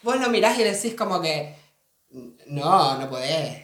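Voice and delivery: put-on light Argentine accent; easygoing mock Argentine, "che". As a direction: friend doing a relaxed Argentine accent. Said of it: "Es un acento, gente".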